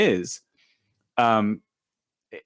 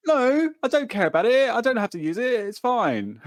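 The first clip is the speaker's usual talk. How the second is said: high put-on English accent; mock English accent, lifted pitch; 9 semitones higher than usual. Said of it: "You sound like Steven from Moon Knight".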